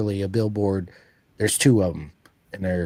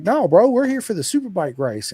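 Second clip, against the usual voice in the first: higher and louder, insistent; pitched-up "no, bro" protest; emphatic correction; 10 semitones higher, 6 dB louder than usual. Retold or imitated. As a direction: set him straight with a grin in the voice. Correcting the pizza guy.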